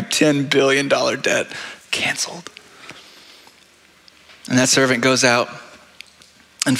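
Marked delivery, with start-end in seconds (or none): 0.0-2.5 breathless whisper of wonder; hushed marvel at a debt wiped out; whispered awe. Real, not acted. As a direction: whisper it like you can hardly believe it.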